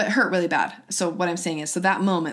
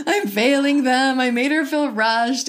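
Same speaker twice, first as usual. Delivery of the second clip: higher, louder and slow, near tears; anguished; 6 semitones higher, 6 dB louder, slower than usual. Retold or imitated. Mom reliving her breakdown after school, anguished and slow. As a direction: let it break out of you slowly. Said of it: "I actually just cried".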